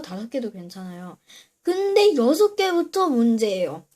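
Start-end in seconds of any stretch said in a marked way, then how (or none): 1.6-3.9 higher and louder on the punchline; excited punchline. Real, not acted. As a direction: punch up the payoff. Counting up to the punchline.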